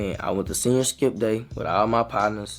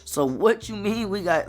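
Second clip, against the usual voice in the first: indignant protest; 9 semitones higher than usual. Retold or imitated. Protesting the accusation to police.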